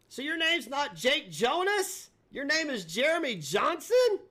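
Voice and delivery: high, loud, drawn-out disbelief; mock astonishment. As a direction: feign huge shock, pitch leaping up. Mock shock at a name reveal.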